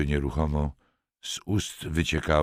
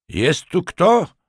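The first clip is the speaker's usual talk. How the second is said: high, loud and slow call into the dark; shouted call; 13 semitones higher, 7 dB louder, slower than usual. A narrator's reading. Sergeant shouting into the silent dark, a loud slow call. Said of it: "krzyknął".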